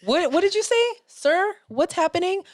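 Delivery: high shocked squeal; shocked disbelief. Retold or imitated. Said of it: "everybody was like".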